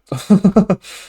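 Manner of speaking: short, high laugh with an odd, unfamiliar tone; self-mocking laugh. Real, not acted. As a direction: laugh at your own optimism. Laughs at own unlikely goal.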